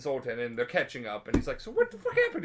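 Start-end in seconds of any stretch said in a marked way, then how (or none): 1.6-2.4 much higher hammed-up question; squeaky mock question. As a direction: ask it in a silly squeak. Geralt asks what happened.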